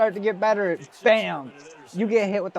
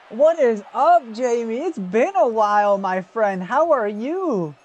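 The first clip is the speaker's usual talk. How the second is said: slow, higher, drawn-out welcome; hearty greeting; 4 semitones higher, 3 dB louder, slower than usual. Real, not acted. Streamer welcoming Jamie back after a while, hearty greeting. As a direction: stretch the words in a big, swooping hello.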